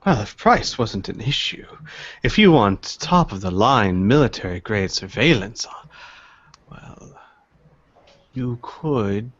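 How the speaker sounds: put-on measured old dealer, pausing to recollect; knowing old dealer. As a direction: think aloud slowly, then explain discreetly.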